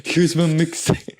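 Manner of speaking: high, slow hustler handing out a mixtape. Retold or imitated. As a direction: shove your mixtape at them. Mocking a street mixtape pusher.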